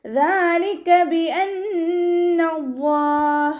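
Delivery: slow, high, melodic tajweed chant; Quran recitation. Shown as a demonstration. Teacher chants the verse for students, slow and melodic. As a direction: draw each syllable out in a sung recitation.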